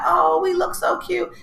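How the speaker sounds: put-on high, gushy girlish quote; mock gushing. Mimicking her cooing while doing squats, high and gushy. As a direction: play up the cute, sing-song delight.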